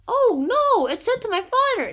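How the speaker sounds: high, loud dismayed outburst; mock alarm. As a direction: cry out in theatrical horror at the discovery. Official discovers father's name.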